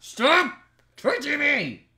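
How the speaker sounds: shouting in annoyance; angry shout. Real, not acted. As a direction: yell at him to stop. Friend grabbing him, real shout.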